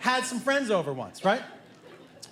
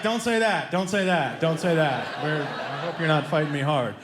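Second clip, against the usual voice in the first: mock placating; 6 semitones lower than usual. Dad soothing the furious son.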